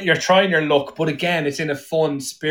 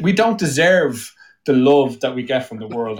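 ordinary delivery

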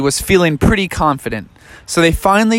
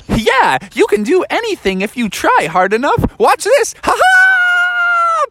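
much higher, building to a gleeful shout; excited Daniel Tiger. Acted. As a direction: cheer yourself on before the drop. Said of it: "He's like".

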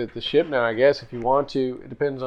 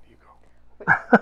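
whisper; 8 dB louder than usual. Acted. Teasing spouse, whispering aside.

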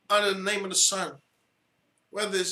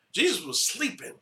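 usual speaking voice

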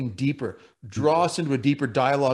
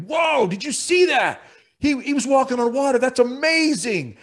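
awestruck disciples; 9 semitones higher than usual. Pretend. Disciples in the boat watching Peter, higher and amazed. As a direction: gasp in wonder at the miracle. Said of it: "those guys in the boat are thinking".

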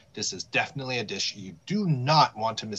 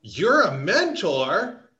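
surprised; 7 semitones higher, 6 dB louder, much slower than usual. Real, not acted. Surprised question.

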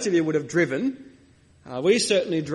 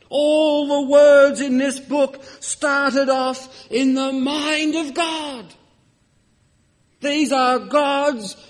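raised, slow, ringing pulpit voice; preaching at full force; 6 semitones higher, 5 dB louder, slower than usual. Acted. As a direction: proclaim it to the whole church.

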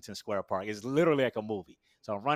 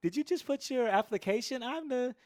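much higher, bright welcoming greeting; the program director welcoming a breathless applicant; Doctor Moore; 11 semitones higher than usual. Retold or imitated. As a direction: greet a new student as a friendly director. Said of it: "Doctor Moore".

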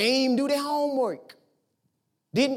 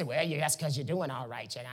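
low, softer mock objection; lightly voiced skeptic, pitched down; imitating doubters; 10 semitones lower, 7 dB quieter than usual. People answering the preacher's joy, imitating doubters. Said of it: "Now, people say".

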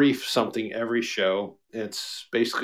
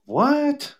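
high, baffled squeak; mock confused listener; confused what. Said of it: "listen to that later and go".

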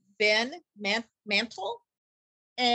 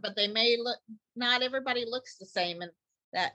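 ordinary talk

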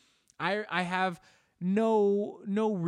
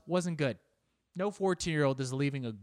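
usual speaking voice

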